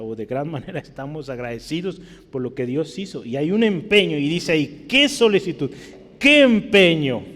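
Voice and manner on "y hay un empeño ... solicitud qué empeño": higher and louder, slowed on each "qué"; preaching at full force. Acted. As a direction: proclaim it to the congregation. Predica leyendo el versículo. (predicación a plena voz, lenta y fuerte)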